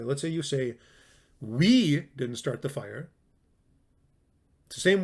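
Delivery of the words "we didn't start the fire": "We" drawn out and set apart, slow; stress on WE. Shown as a demonstration. Teacher showing students what stressed words imply, stress on "We". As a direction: lean hard on "We", then the rest follows. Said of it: "let's say you stress it this way".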